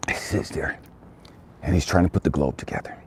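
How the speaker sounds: ordinary talk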